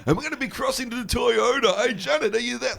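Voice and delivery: high, bright breakfast radio host voice; mock eighties radio DJ patter; hammed-up morning show presenter. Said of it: "why are you speaking like you're on some sort of, like, morning show?".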